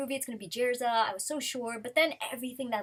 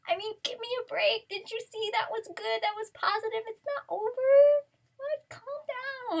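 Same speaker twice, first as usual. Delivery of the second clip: much higher, squeaky put-on voice, pleading and exasperated; mock Mashima scolding fans; 12 semitones higher than usual. The author pleads with upset fans, in a high mock whine. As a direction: play the author whining that it was only a joke. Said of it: "He's like".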